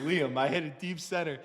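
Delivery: ordinary talk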